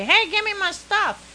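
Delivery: high-pitched playful call at a delivery driver; mock demand. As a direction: pretend to holler for your package. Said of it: "knock on the door and say".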